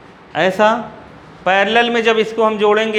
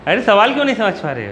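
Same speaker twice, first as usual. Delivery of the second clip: rushed, frustrated teacher; teacher rushing at students who don't understand; fast exasperated question; faster than usual. Real, not acted. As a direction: rattle it off in exasperation. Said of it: "धीरे धीरे बोलो sir".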